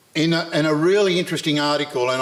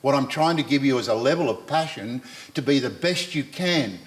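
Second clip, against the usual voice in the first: ordinary talk, nothing marked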